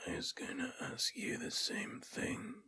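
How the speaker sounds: raspy whisper of a dying dwarf; guttural dwarf voice. Acted. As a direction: rasp it out like a withered corpse. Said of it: "guttural voice speaking in Dwarven".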